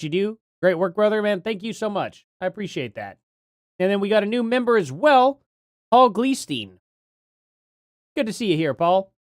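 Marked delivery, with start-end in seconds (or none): 6.1-6.7 "Gleestein" with a long EE, GLEE-steen; GLEE pronunciation. Shown as a demonstration. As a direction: read the name "Gleestein" starting like glee. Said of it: "Or is it Gleistein?".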